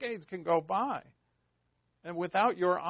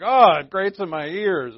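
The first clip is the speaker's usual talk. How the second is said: loud, higher, drawn-out mock agony; mock pained complaint; 4 semitones higher, 12 dB louder than usual. Preacher playing a listener to a grating voice, mock pained. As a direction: groan it out slowly and loudly, as if hurting. Reacting to imagined nasally voice.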